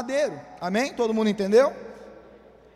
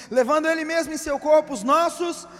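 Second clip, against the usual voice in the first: louder solemn Bible reading; scripture reading; 2 semitones higher, 5 dB louder than usual. Acted. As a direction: read the verse with weight. Reads scripture aloud.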